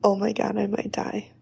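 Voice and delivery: whispered mock terror; host whispers her fright over a sketch; scared whisper. Real, not acted. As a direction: breathe it out without voice. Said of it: "I'm so scared".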